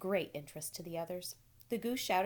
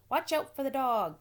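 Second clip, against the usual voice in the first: loud, high warning cry; sheep crying out; 7 semitones higher, 8 dB louder than usual. A narrator's reading. Sheep crying a warning to Wilbur, loud. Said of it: "cried the sheep".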